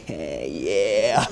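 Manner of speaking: drawn-out, chuckling "yeah"; pleased chuckle. Retold or imitated. Preacher re-enacting finding money in his jacket, pleased chuckle.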